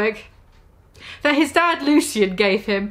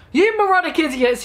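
villain shout; 5 semitones higher than usual. Acted. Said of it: "he shouted angstily".

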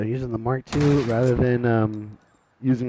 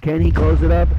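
higher play-by-play, building to a loud question; excited commentary; 4 semitones higher, 4 dB louder than usual. Real, not acted. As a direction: hype the suspense, punching it louder. Excited commentary on a kill streak.

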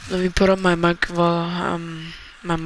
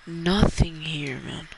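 whispering; much slower than usual. Real, not acted. Whispered aside in game.

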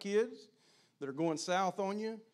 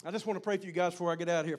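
ordinary talk

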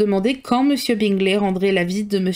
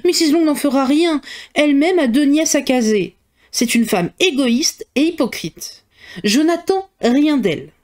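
higher, peevish mother, slowing at the end; lightly voiced fretful mother; 5 semitones higher, 3 dB louder than usual. A narrator's reading. Mother complaining about Mrs Long to her daughter, mildly peevish. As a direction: grumble at the rival like a put-out mother.

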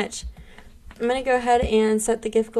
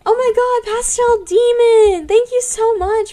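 excited shout; 12 semitones higher, 7 dB louder than usual. Real, not acted. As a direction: shriek the name with delight. Thanks viewer for gift goal.

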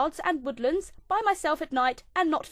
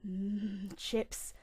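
hushed craving; 7 semitones lower, 10 dB quieter than usual. Real, not acted.

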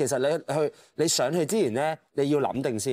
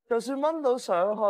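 normal talking voice

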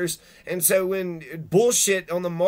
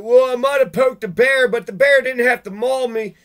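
a loud, higher put-on whine; mock whiny excuse; 5 semitones higher, 7 dB louder than usual. Ranter mocks the poker's excuse in a higher whine. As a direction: play the aggressor making excuses.

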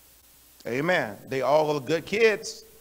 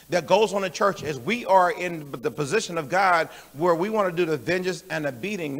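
ordinary delivery

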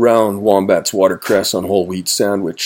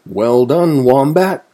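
louder, higher praise; cheering cry; 6 semitones higher, 5 dB louder than usual. A narrator's reading. Weasel and Woodchuck congratulate Wombat, crying out. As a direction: cheer a friend on brightly. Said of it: "cried Weasel and Woodchuck".

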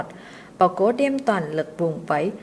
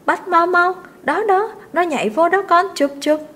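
very high, loud, breathless urging; excited shouting; 9 semitones higher, 5 dB louder than usual. A narrator's reading. Mistress shrieking at the servant to catch the bird, wildly excited. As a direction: shriek them on to grab it.

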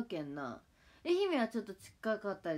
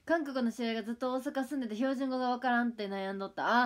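usual speaking voice

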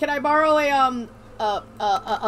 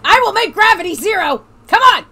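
loud, high yell at the game; frustrated shout; 5 semitones higher, 7 dB louder than usual. Real, not acted. Player urging the ball into zero gravity, frustrated shout. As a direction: shout it out, rising to a louder plea.

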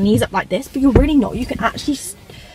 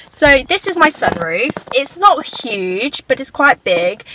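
ordinary delivery

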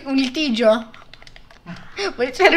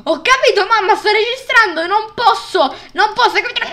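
exasperated; 6 semitones higher, 4 dB louder than usual. Real, not acted. Exasperated reply to interrupting mum.

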